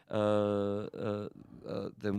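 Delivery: halting, stumbling over words; stuttering through the sentence. Real, not acted. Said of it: "I'm stuttering here".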